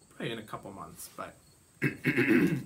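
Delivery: throat-clearing, a little hoarse; frog in throat. Real, not acted. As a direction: clear the throat, then carry on. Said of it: "I had a frog in my throat today".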